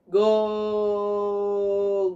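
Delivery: ordinary talk, nothing marked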